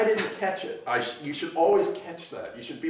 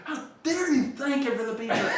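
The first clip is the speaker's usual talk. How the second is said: high-pitched retold indignation; mock outraged Ralph; 10 semitones higher than usual. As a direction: imitate the boss scolding, hammed up. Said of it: "legendary stories about Ralph's yelling".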